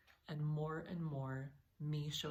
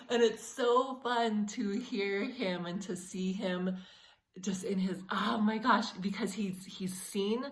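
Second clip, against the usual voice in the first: emotional; 4 semitones higher, 8 dB louder than usual. Real, not acted. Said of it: "I'm getting a little emotional".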